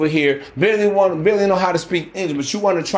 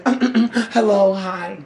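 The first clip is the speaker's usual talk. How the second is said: softened feminine voice; 3 semitones higher, much slower than usual. Acted. Woman greeting a masculine man, mock-feminine voice. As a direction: imitate a woman turning suddenly girlish. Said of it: "her intonation of her voice".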